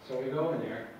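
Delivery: ordinary talk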